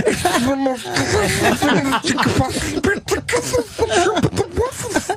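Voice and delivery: high, giggling delivery; can barely get it out for laughing; cracking up; laughing hysterically. Real, not acted. Laughing hysterically about shrimp.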